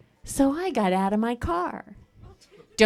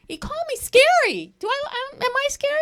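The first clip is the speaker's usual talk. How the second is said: high, faux-innocent question; mock-offended squeak. Comic feigning shock at being called scary, high and innocent. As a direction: play innocent, voice way up.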